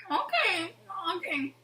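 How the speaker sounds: small, soft, high okay; retold timid squeak of agreement; meek, uneasy acceptance. Child watching cast go on, meekly uneasy.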